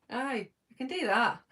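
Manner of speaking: put-on broad Scottish accent. Shown as a demonstration. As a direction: lay the Scottish accent on thick. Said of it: "better at doing a bit of Scottish".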